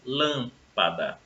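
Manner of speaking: drawn out syllable by syllable, very slow; Lâmpada stretched, each syllable held; slow example word. Shown as a demonstration. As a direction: spell out Lâmpada slowly so students hear the stressed syllable. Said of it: "Lâmpada recebe acento porque ela é uma palavra proparoxítona".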